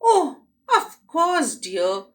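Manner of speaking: 1 surprised mother. Acted.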